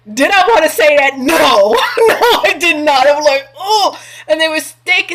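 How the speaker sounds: high, loud laughing admission. Real, not acted. Laughing about inviting the ex along when she didn't want to, high and loud. Laughing at her forced politeness.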